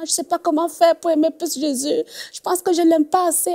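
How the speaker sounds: high-pitched imitation of tearful worry; retold tearful confession. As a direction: plead as if quoting someone starting to cry. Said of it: "Elle se mettait à pleurer carrément".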